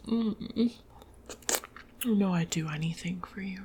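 ordinary talk, nothing marked

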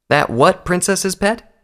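high, slow, rising disbelief; indignant retort. Acted. Applejack challenges an insult to her princess, indignant retort. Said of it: "Applejack retorted".

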